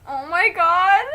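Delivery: loud, high real outburst of shock. Real, not acted. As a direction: cry out at the twist. Reader reacts to death.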